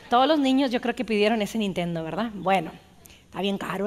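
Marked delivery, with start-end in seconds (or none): 3.3-3.9 softer aside. Real, not acted. Aside about the expensive Nintendo.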